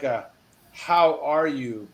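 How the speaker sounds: normal talking voice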